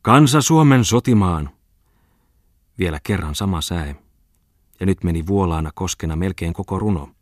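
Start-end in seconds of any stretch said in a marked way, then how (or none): 0.0-1.5 loud, high, slow declamation; vigorous declaiming. Acted. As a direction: hurl the verse out with renewed energy. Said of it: "Sitten hän alkoi tarmolla taas".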